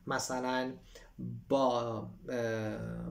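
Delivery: normal talking voice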